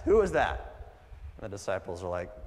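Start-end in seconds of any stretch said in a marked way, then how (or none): 0.0-0.9 higher, louder searching question; urgent call. Retold or imitated. Jesus calling out to the crowd, urgent and loud. Jesus asking who touched him.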